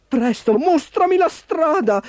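high, urgent command. Acted. Ford urging Baby to show the way, urgently.

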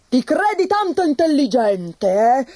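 put-on high, sharp outburst from a grumpy old tsar; peevish old tsar lashing out at his wife; snapping tsar. A narrator's reading. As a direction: bite the words off in irritation. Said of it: "sbottò un giorno".

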